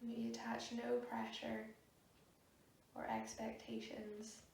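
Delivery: soft, unhurried meditation voice with long pauses; guided meditation. Real, not acted. Yoga teacher guiding a class to sit and breathe, soft meditation voice.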